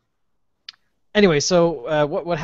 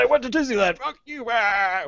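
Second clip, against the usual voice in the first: high, slowed hammed-up rage; hammed-up revenge boast at the phone company; mock furious high voice; 9 semitones higher than usual. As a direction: spit it out like a sulking kid. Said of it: "I was so mad".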